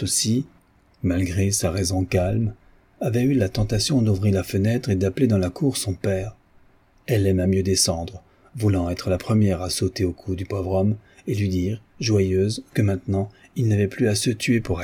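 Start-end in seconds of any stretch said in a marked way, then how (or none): none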